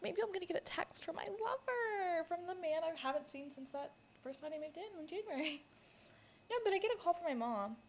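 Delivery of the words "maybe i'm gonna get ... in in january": high, soft, whimpering longing; hammed-up wistful yearning; mock lovesick pining. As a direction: pine for a lover in a put-on tearful whine. Acting her lovesick self awaiting text.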